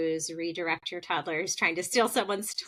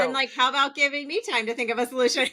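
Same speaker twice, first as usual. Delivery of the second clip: mock demand; 6 semitones higher, 5 dB louder, faster than usual. Joining the mock retort.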